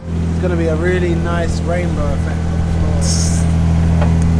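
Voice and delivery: slow, loud tradesman deadpan; gruff plain mechanic. Acted. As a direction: drawl it like a weary mechanic. Mock mechanic remark on oil spill.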